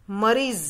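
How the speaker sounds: मरीज ending on a clear z, as "mareez"; मरीज as "mareez", the z sound carefully shown; careful pronunciation. Shown as a demonstration. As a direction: say मरीज slowly, with the nukta z clear at the end. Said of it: "मैं जो उच्चारण कर रही हूँ वो ध्यान से सुनिए आप".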